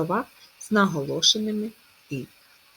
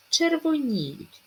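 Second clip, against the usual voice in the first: "Червоніють" slow, high on stressed ні; "Червоніють", drawn out to show the stressed ні; 8 semitones higher, 3 dB louder, much slower than usual. Shown as a demonstration. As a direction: stretch the і in "Червоніють". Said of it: "наголос на і".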